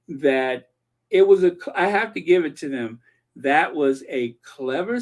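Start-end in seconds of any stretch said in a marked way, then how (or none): none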